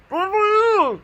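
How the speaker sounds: high, loud, garbled mask voice; imitating a masked man's muffled holler; muffled through a mask. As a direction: shout an unintelligible word into a mask. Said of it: "So this is his mask".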